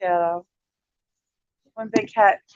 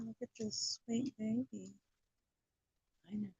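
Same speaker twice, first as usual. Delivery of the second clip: cooing softly; 15 dB quieter than usual. Real, not acted. Cooing at a kitten.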